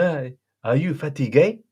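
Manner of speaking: imitating a friend. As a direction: ask it as the French-speaking pal, lilting upward. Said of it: "my friend, friend said to me".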